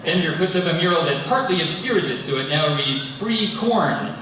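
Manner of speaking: usual speaking voice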